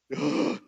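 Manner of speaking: a sharp, loud startled gasp; shocked gasp. Acted. Preacher acting out a believer caught off guard, sharp gasp.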